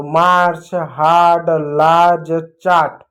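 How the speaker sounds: मार्च, हार्ड, लार्ज, चार्ट one by one, आ held; long आ sound. Shown as a demonstration. Teacher recapping examples, long आ in मार्च, हार्ड, लार्ज, चार्ट. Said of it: "ए को हमने आ पढ़ा".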